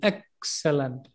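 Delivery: Excellent as EX, then low, slow "sulunt"; Excellent, falling away softly after EX; first-syllable stress. Shown as a demonstration. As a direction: hit EX in Excellent and let the rest sink. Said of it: "It becomes very low and slow".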